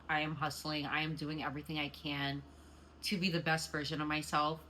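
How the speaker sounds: ordinary talk, nothing marked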